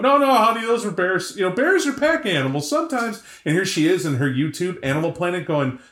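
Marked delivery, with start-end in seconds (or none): 0.0-3.2 higher, mock know-it-all father; patronising dad. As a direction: talk down to a child from the driver's seat. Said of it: "The dad going".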